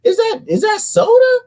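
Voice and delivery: high fussy lecture; high preachy comeback about chips and soda; mock health nut. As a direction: fuss at them high and preachy. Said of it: "I'm like".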